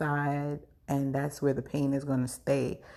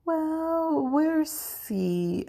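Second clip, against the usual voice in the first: a drawn-out high "Well", then slow and hesitant; ominous doctor hedge; 11 semitones higher, much slower than usual. Retold or imitated. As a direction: stall the news like a doctor dreading it. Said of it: "I don't like how he said it like that".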